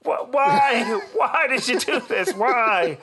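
high, loud, disbelieving what and why; exasperated yelling. Retold or imitated. Father shouting at his son over the flooded bath, shocked and exasperated. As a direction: cry out at the mess in shock.

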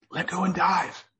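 high, urgent hissed command. Acted. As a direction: hiss the order from hiding. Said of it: "hissed the voice from backstage".

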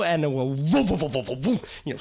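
rhythmic vroom noises of being tossed underwater; imitated tumbling. Surfer acts out getting thrashed by the wave with vroom noises. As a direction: mimic the wave churning you around.

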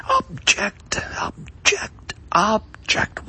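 three sharp, high, loud objections; outraged objection. Acted. Defense lawyer cuts off the prosecutor, high outraged shouts.

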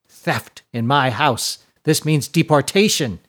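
slow, loud righteous fury; outraged accusation. Acted. Sister Princeps condemns Ashlyn for theft, loud and slow. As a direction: hammer each word down in outrage.

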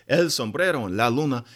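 El Sombrero and La Luna with a Spanish accent. Shown as a demonstration. Correcting himself into a Spanish accent for El Sombrero and La Luna. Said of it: "To really use the accent".

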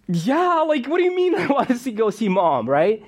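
put-on a boy who can't believe the good news; eager child. Boy told he can see mom.

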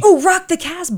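high, loud eager cry; excited. Real, not acted. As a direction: blurt it out with excitement. Excited recognition of song.

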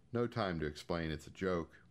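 usual speaking voice

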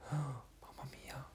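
breathy gasp, then a slow whispered mamma mia. Real, not acted. Realizing his flight is boarding, gasping whisper. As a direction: whisper it in sudden dismay.

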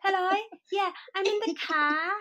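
put-on high, loud amateur Australian accent. Sister in Australia on a call, imitated Australian accent. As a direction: imitate an Australian on the phone, roughly. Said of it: "I'm not saying I'm good in Australia".

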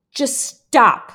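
forceful command. A narrator's reading. The one boy standing up to the others, forceful command. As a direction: cut them off with force. Boy intervenes to end assault.

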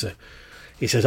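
ordinary talk

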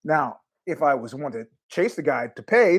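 ordinary delivery

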